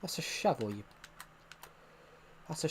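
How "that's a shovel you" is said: normal talking voice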